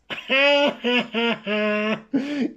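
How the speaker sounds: silly puppet laugh; puppet giggle. Acted. Laughs at joke.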